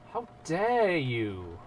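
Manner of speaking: hammed-up offence, dragging out DARE; drawn-out mock outrage. Mock offence, repeated for effect.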